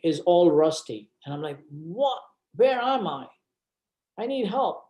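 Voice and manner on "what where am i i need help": acted bewilderment. Reenacts waking bewildered in hospital.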